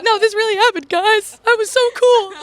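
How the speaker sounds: high, loud granny protest; old woman. Pretend. Future grandma answering doubting grandchildren, loud mock protest. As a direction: insist like a hammed-up indignant old lady. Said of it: "eighty years old, I'm like".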